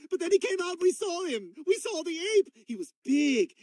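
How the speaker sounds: high, eager recounting; wide-eyed childish excitement; excited child storytelling. Acted. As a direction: gush about it with rising pitch. Excited child recounts zoo trip.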